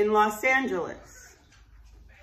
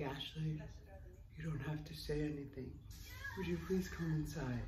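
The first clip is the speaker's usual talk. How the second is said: low, soft gentle plea; old man; 6 semitones lower, 15 dB quieter than usual. Retold or imitated. Old interventionist calls Ashley inside, low hushed plea. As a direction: murmur it deep and hushed.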